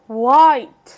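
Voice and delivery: व्हाइट with a full "eye" vowel, loud; long I sound. Shown as a demonstration. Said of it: "तो वो आई ही".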